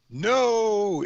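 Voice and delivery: high, drawn-out "no"; mock angry shout. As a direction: imitate a heckling crowd in a high voice. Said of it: "met with angry shouts".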